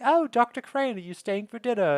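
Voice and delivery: high-pitched, badly faked English accent; imitating Daphne. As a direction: chirp it in a bad English accent. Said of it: "My English accent is terrible".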